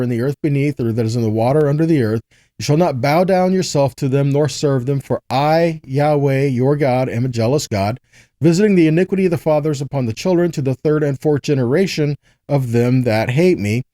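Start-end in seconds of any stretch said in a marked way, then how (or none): none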